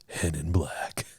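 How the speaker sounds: low whispered trailer voice; movie trailer voice. Acted. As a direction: breathe it like a dramatic movie trailer. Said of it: "Can you use that voice for the rest".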